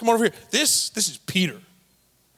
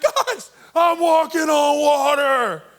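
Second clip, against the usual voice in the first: slow, high, loud laughing shout; 6 semitones higher, 5 dB louder, slower than usual. Acted. Peter shouting in laughing delight to his friends in the boat. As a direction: shout with giddy delight at walking on water.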